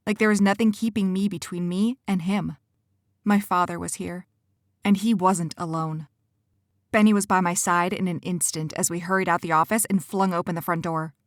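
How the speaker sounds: usual speaking voice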